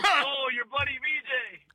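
high, slow, drawn-out mock holler; mock calling out. Comic retelling embarrassing mix-up, mock calling out. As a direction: stretch it out in a high mocking call. Retold calling out, mocking himself.

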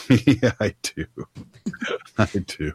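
chuckling through the words; amused agreement. Real, not acted. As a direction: agree with a little laugh. Teased by co-host, laughs along.

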